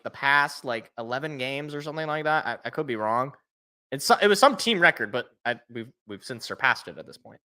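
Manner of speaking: ordinary delivery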